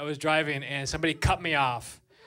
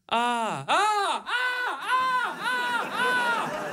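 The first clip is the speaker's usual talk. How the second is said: scared ahs climbing to a screaming wail; whimpering ahs that build to a high scream; frightened escalating ahs; much slower than usual. Retold or imitated. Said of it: "If you were afraid of your own voice".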